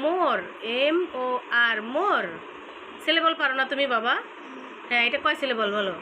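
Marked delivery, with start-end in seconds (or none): 0.0-2.3 "more", then "এম ও আর" letter by letter, then "more"; teacher sounding out "more" as one separate syllable. Shown as a demonstration. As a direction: spell out "এম ও আর" with a gap between the parts. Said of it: "syllable ভাগ করো".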